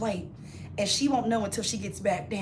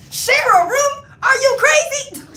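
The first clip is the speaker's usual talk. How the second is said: very high, loud shocked cry; outraged disbelief; 14 semitones higher, 10 dB louder, slower than usual. Retold or imitated. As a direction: shriek at the very idea. Outraged reply to sister.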